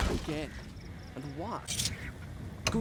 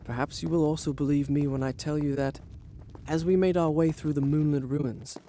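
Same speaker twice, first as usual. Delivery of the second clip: story narration. A narrator's reading. Prince narrates his tale.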